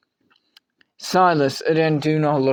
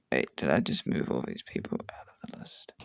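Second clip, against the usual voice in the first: whisper; 11 semitones lower, 9 dB quieter than usual. Real, not acted. Alarmed he lost his list.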